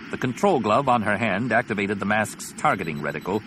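ordinary delivery, nothing marked

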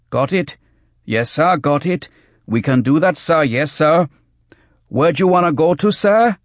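high, loud, eager-to-please tout; servile street tout. Acted. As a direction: yelp it out, anxious to oblige. Tout yields to threat.

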